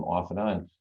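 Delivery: normal talking voice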